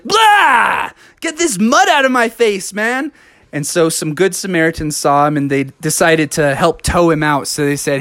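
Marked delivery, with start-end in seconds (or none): 0.0-3.1 disgusted yell. Acted. Bubby gets mud sprayed in his face, disgusted yell. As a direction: spit out the mud and complain. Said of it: "he was like".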